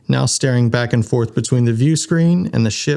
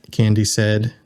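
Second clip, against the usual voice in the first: usual speaking voice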